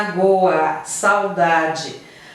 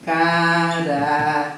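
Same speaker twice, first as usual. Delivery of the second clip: Caramelo drawn out slowly, syllable by syllable; slow it down to show the syllables; 3 semitones lower, much slower than usual. Shown as a demonstration.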